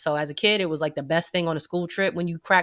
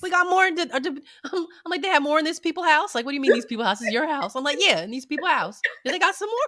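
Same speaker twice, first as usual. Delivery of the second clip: put-on high pleading kid voice; 9 semitones higher, faster than usual. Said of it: "you think, like".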